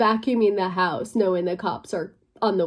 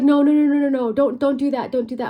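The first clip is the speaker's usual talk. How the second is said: louder urgent mock pleading; mom panicking; 5 semitones higher, 6 dB louder, faster than usual. Mom begging her not to call cops, urgent pleading. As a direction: beg her to stop, rising alarm. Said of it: "and she's like".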